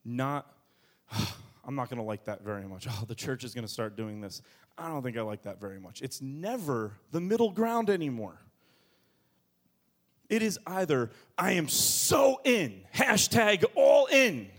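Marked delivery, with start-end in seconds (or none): 1.7-6.0 grumbling churchgoer. Acted. 11.4-14.5 higher, louder, slow gushing; gushing all in. Acted. Pastor mocking all-in churchgoers, gushing higher and slower.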